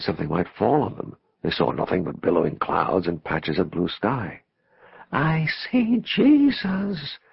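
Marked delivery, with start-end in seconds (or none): 5.1-7.2 very slow, high-pitched wonder; high, drawn-out visionary amazement; hushed awe. A narrator's reading. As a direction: stretch it out in rapt awe. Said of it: "Dee said in a hushed voice".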